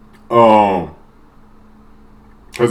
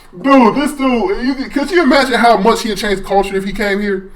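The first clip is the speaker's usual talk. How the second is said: excited laughing; 10 semitones higher than usual. Real, not acted.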